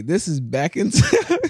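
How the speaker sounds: real laugh breaking into the words; slow down and crack up mid-phrase. Real, not acted. Explaining himself to his girlfriend, breaking into laughter.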